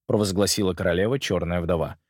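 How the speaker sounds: ordinary delivery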